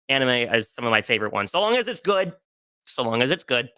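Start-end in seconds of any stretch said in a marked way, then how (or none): 1.5-2.3 high-pitched, lilting proviso; playful caveat. Real, not acted. Adding a condition on loving romance anime, high and lilting. 2.8-3.7 matter-of-fact caveat. Real, not acted. Repeating the condition on romance anime, settled and matter-of-fact.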